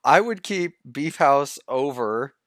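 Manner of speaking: ordinary talk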